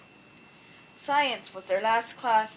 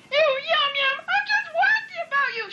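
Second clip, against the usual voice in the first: very high, loud fan shriek; 4 dB louder than usual. A narrator's reading. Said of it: "shrieked Millie the Millicent".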